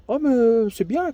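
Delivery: imitating the doctor's pleased surprise; doctor surprised. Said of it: "qu'elle me dit".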